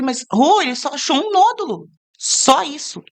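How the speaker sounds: high-pitched, insistent downplaying; animated, disbelieving protest; retold incredulous reassurance. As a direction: act out talking a friend down. Retold reply downplaying friend's panic.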